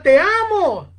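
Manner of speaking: high, loud heartfelt cry; mean every word at full voice; sincere shout. Retold or imitated. Francis shouts love a third time, sincere full cry. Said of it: "con profunda sinceridad".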